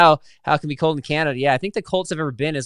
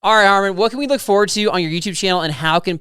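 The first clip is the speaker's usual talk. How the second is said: upbeat host; 5 semitones higher, 6 dB louder than usual. Real, not acted. Host wraps up interview.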